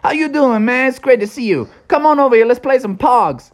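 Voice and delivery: lightly voiced hyped friend; Iggy greeting. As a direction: greet him like an old buddy. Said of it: "Iggy was there".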